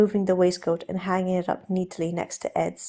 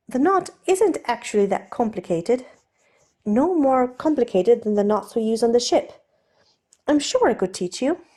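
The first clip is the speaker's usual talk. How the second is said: raised, lighter voice for the nervous aristocrat; Steed, flustered gentleman; 4 semitones higher, 4 dB louder than usual. Acted. Steed offering to undo Ed's cravat, nervously polite. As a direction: play Steed, polite and a little jittery.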